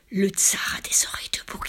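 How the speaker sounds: eerie unvoiced whisper; hushed secret on the air; whispered flute words. A narrator's reading. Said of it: "ce furent des paroles".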